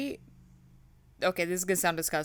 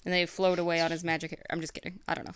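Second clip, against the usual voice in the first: ordinary talk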